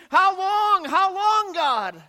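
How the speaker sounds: high, loud plea to God; a hurting church member begging God to end it, loud cry; pleading cry. Acted. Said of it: "they're thinking".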